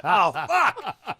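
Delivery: high, loud gutted curse; sudden loud dismay; dismayed outburst. Retold or imitated. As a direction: blurt it out in horror. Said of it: "I'm like".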